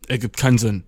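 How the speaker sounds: ordinary delivery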